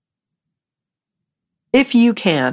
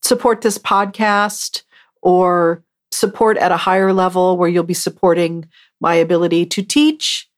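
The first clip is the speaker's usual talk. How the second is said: ordinary talk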